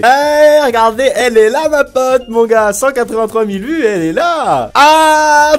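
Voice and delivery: loud, high burst of excitement; excited discovery. Real, not acted. Showing viewers his friend in the video, excited shout. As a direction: call out high and loud, thrilled.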